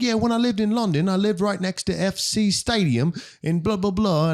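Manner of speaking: high, imitated British chat; British accent. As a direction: put on a chatty Brit's accent. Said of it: "like, this British accent".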